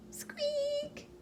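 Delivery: tiny high squeaky mouse noise; mouse squeak. Acted.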